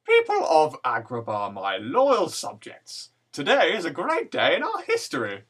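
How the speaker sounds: put-on slow grand old sultan voice; grand languid royal commands. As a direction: address your subjects like an aged king. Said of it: "can you give us a bit of your Sultan voice".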